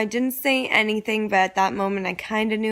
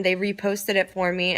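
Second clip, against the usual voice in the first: usual speaking voice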